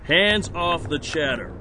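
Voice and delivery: high, firm officer's orders; police command. Acted. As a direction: command a suspect to comply at once. Officer orders suspect's hands up.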